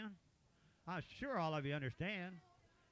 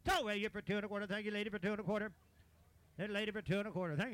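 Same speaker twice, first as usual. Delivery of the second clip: auctioneer; 3 semitones higher, faster than usual. Real, not acted. Auctioneer calling bids.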